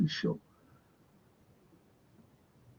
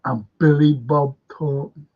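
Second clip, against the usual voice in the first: ordinary talk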